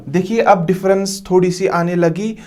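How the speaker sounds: ordinary talk, nothing marked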